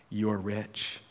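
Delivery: soft repeat. Shown as a demonstration. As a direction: let the repeat sink in quietly. Preacher repeats for effect.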